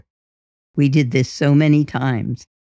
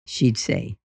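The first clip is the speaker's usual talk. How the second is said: plain reading voice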